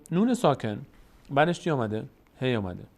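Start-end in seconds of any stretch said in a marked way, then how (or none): none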